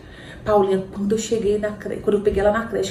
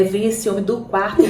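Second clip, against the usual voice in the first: ordinary talk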